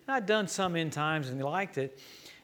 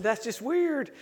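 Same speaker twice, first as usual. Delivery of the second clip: amused disbelief; 7 semitones higher, slower than usual. Retold or imitated. Preacher reacting to odd claim.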